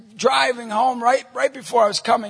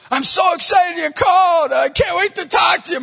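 high, loud gushing voicemail; gushing wife; 10 semitones higher, 6 dB louder than usual. Retold or imitated. Wife leaving her husband an early message, gushingly excited. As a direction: imitate an over-eager, excited caller. Acting wife's eager voicemail.

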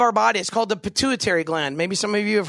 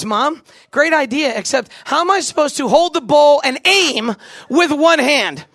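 louder, slow, exasperated protest; 5 semitones higher, 5 dB louder, slower than usual. Retold or imitated. Boy to mom in hospital bed, exasperated louder protest. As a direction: push the objection hard at the bowl idea.

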